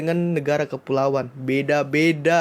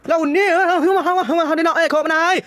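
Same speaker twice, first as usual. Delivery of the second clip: mock Padang accent; 12 semitones higher, 5 dB louder than usual. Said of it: "Gambarannya kayak gitulah".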